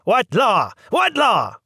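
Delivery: put-on shrill old lady yelling, loud and high. A narrator's reading. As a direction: yell it back in outrage. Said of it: "The old lady yelling".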